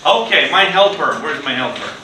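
usual speaking voice

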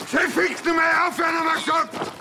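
very high, loud shouted order; angry yell. Acted. Officer ordering brawling men to stop, angry yell. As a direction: yell at full pitch to end the fight.

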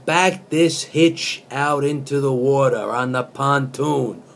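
earnest spoken-word reading of song lyrics; deadpan poet reciting pop lyrics; poetry reading. Acted. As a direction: read it like serious poetry.

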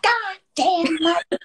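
high, slow, drawn-out mock cry of frustration; mock frustrated outburst. Telling a friend how to let screams out, high and drawn out. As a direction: stretch it out high like a dramatic release. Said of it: "and be like".

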